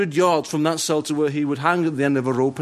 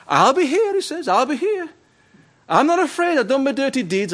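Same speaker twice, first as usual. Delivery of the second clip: much higher, cocky and unafraid; defiant; 11 semitones higher than usual. Retold or imitated. Prisoner to prison governor, cocky defiance. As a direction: throw it back at him proudly. Condemned man defies governor.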